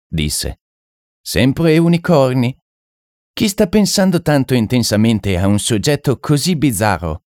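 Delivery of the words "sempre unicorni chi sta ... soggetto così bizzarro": high-pitched, puzzled French mystic; French medium. Acted. French medium sensing someone's odd thoughts, high-pitched and intrigued. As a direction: play the intrigued foreign occultist, pitched up.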